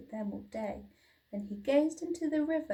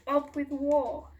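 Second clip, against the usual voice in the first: louder, higher chant; mock shout; 4 semitones higher, 6 dB louder than usual. A narrator's reading. Sisters shouting their hunting chant, louder. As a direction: chant it like a war cry. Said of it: "they shouted".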